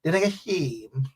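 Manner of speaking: soft, rhythmic Guyanese country twang; stylish Corentyne twang. Shown as a demonstration. Coaching viewers on style, rhythmic Guyanese twang. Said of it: "you gotta say it with style".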